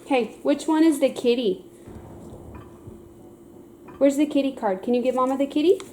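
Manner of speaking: high, bright sing-song praise and questions. Real, not acted. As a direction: coax a little one to play. Mom quizzing toddler with cards.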